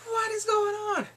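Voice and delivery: very high-pitched, baffled outburst; mock bewilderment. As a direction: squeal it in exaggerated confusion. Retells own baffled reaction to plot.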